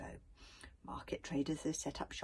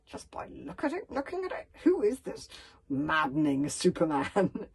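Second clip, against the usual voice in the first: gruff grumbling; 6 dB louder than usual. A narrator's reading. Stoic privately resents the maddening bodyguard, gruffly grumbling. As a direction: grumble it, stretching the final words. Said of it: "gruffly thinking".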